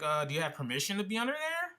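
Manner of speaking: mock an officious questioner, pitch climbing at the end; questioning busybody. Stranger challenges the repairman, imitated suspicious questioning. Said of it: "He's like".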